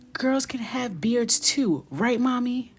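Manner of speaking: put-on high, loud and slow kid voice; a little boy innocently piping up to Mommy; mock child voice. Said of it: "he's like".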